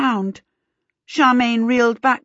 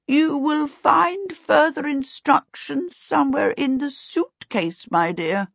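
kindly great-uncle; 7 semitones higher than usual. A narrator's reading. Great-uncle's voice instructs Charmaine amid the hissing, kindly. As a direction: give kindly guidance, lifted then dropping away. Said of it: "Great-uncle William's kindly voice spoke".

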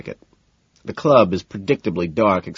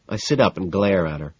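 ordinary delivery, nothing marked

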